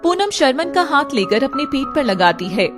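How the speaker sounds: ordinary talk, nothing marked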